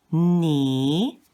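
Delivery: drawn-out "ni" with a rising tone; second tone. Shown as a demonstration. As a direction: slide "ni" upward like a question. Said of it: "The next one is".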